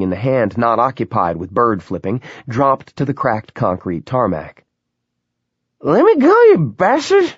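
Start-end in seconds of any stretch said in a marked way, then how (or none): 5.8-7.3 much higher, louder shrieking woman; frightened scream. A narrator's reading. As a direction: scream to break free. Woman being shaken, screams.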